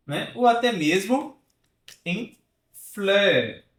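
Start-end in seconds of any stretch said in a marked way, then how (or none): 2.0-3.6 "fleur" with rounded French eu, no final r. Shown as a demonstration. Teacher modelling "fleur" for students. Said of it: "que significa flor".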